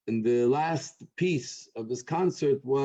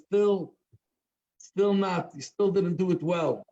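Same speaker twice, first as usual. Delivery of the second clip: high-pitched, mild heckler's yell; half-shouted heckle; mock shouting; 6 semitones higher, slower than usual. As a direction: call out stubborn disapproval from the crowd. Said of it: "shouting at the top of his lungs".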